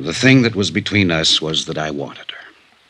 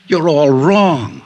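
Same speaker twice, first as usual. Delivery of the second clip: scoffing laugh; 8 semitones higher, much slower than usual. Acted. Scoffs at the detective's idea.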